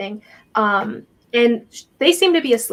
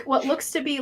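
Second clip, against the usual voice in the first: normal talking voice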